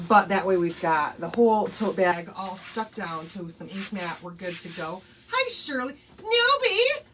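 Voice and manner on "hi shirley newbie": high, loud, slow squeal of welcome; delighted welcome. Real, not acted. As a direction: greet the newcomer with glee. Welcoming a new viewer.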